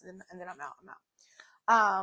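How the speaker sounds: unvoiced whispered aside. Real, not acted. Whispering an aside to viewers before leaving. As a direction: whisper it like a secret.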